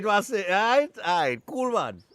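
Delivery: ordinary talk, nothing marked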